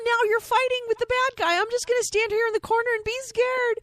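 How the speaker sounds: put-on high, loud helpless girl; damsel voice. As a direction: whine like a scared damsel. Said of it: "like the girl who can't pick up the gun".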